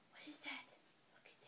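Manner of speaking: mock-amazed whisper; breathless storybook whisper; hushed gasp. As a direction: gasp then whisper to the baby. Gasp reading to baby.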